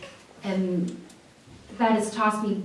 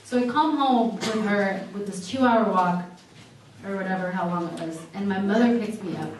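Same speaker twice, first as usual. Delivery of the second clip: ordinary talk, nothing marked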